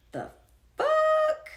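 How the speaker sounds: loud, high-pitched "fuck?"; incredulous outburst. Real, not acted. Scolding herself for giving away power, shocked and loud. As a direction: blurt it in disbelief.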